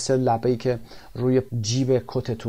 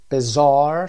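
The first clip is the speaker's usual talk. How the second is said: Bizarre stressed on the last syllable, bi-ZARRE, much louder; word stress demo; 3 semitones higher, 8 dB louder than usual. Shown as a demonstration. Teacher modeling Bizarre's stress for students, loud. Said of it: "استرس لبل رو هم ببینیم".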